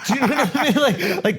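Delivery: high voice through laughter; laughing while talking. Real, not acted. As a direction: talk through the giggles.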